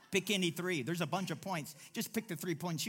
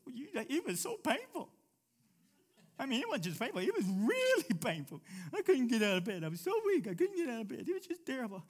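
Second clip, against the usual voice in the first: high, whiny moan about being sick; milk it like a sick patient craving sympathy; mock-pitiful high whine; whiny self-pity; 8 semitones higher, 5 dB louder than usual. Acting out self-pitying patient.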